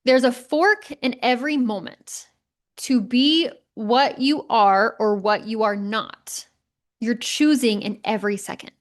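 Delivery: slow, deliberate reading of the quote. Shown as a demonstration. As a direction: read it slowly, word by word. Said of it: "I'm gonna read it".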